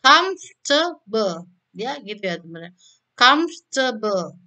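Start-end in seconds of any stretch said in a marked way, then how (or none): 0.0-1.5 Comfortable drawn out slowly as KUMF-ta-ble; teacher stretching Comfortable for the class; slow model word. Shown as a demonstration. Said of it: "comfortable itu tiga". 3.1-4.3 Comfortable drawn out slowly as KUMF-ta-ble; teacher stretching Comfortable for the class; slow model word. Shown as a demonstration. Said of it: "Jadi ada tiga silabel".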